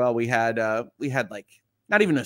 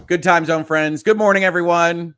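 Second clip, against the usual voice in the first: cheery; 4 semitones higher, 7 dB louder than usual. Shown as a demonstration. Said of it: "I love it".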